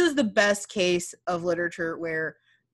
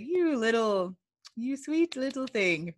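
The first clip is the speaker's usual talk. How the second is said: sweet pet name, a bit softer, faint threat under it; a hint of menace; 4 dB quieter than usual. Torvald sweet-talking his wife, lightly voiced with hidden menace. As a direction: coo it sweetly while meaning a warning. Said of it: "there's that little bit of menace".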